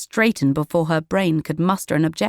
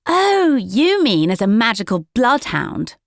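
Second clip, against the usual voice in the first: higher, louder mocking jab; smirking; 6 semitones higher, 4 dB louder than usual. A narrator's reading. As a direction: deliver the insult with a smirk. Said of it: "she smirked".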